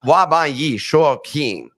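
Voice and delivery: 滑板语速器 said slowly, syllable by syllable in Mandarin; Chinese pronunciation. Shown as a demonstration. Nick demonstrates the Chinese phrase to viewers. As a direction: read 滑板语速器 slowly and carefully. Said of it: "That's how you say the skate deck sold out".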